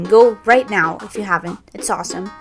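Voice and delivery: normal talking voice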